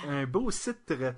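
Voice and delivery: slow "titre" with the final "re" sounded out; deliberate, teasing echo of "titre"; mock echo. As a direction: draw out "titre" to its last syllable. Echoing the joke word.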